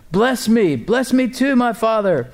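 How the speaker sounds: high-pitched pleading, read aloud; bitter cry. A narrator's reading. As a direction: plead like a desperate son, pitch lifted. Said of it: "burst out with a loud and bitter cry".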